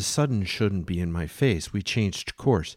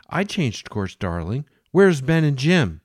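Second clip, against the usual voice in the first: higher, louder voice for Jane; a mad woman serving the captain breakfast; 7 semitones higher, 8 dB louder than usual. Acted. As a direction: play her as doting and unhinged.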